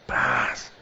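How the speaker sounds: low, soft ripping noise "pras"; tearing sound effect. Acted. Preacher voicing the veil tearing with a low rip. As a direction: mimic the veil ripping apart.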